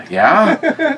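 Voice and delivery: high, rising mock-timid answer; nervous reply. Grandson answering his mad grandpa, imitated nervousness. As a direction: answer warily in a squeaked-up question.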